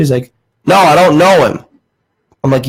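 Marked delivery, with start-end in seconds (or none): 0.7-1.6 higher mock-Southern denial; irritated Southern caller, mimicked; Southern accent. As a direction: imitate a testy old Southern caller. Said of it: "he had a southern accent".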